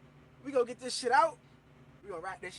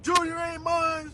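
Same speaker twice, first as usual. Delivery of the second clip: raised, higher-pitched mock wail; retold crying yell; 5 semitones higher, 5 dB louder than usual. Man calling his girl over the test, mock crying yell. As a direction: wail it out as a hammed-up accusation. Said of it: "I'm yelling, screaming, and crying".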